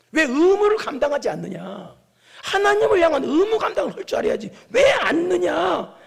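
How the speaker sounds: high, loud, accusing challenge; shouted rebuke. Acted. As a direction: build to a shouted reprimand. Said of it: "하고 책망을 하니까". (언성 높여 호통치듯이)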